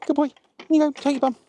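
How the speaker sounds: high voice for the dog. Real, not acted. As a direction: settle the dog with a high coo. Settling the dog down.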